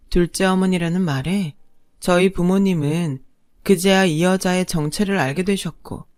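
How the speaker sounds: ordinary delivery, nothing marked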